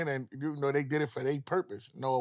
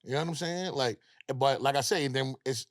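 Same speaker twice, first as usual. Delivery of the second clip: usual speaking voice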